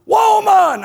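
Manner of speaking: booming high shout across the house; old man hollering for his wife. Retold or imitated. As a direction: bellow for the wife from the lounge chair. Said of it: "he hollered out".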